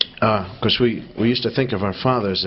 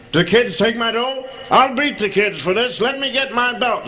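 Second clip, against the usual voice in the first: higher, louder mock rage; father blaming the kids for missing money; angry father; 10 semitones higher, 4 dB louder than usual. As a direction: threaten like a furious dad.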